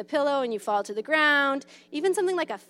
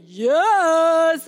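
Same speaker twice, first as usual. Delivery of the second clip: louder yes; 5 semitones higher, 8 dB louder than usual. Shown as a demonstration. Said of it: "if I say".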